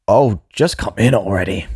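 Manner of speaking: a touch of impatience at the door; impatient. Acted. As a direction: hurry him in with mild annoyance. Impatient old man at the door.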